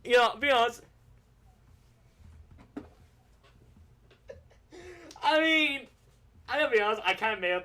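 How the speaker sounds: loud, high, laughing admission; laughing confession. Real, not acted. Admitting to Joe the story was made up, laughing loudly. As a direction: crack up as you come clean.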